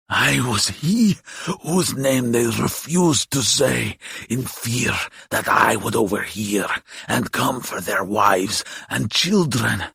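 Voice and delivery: slow, croaky monster voice; drawn-out, menacing creature; boasting old demon. A narrator's reading. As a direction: boast of past terror as a dying demon.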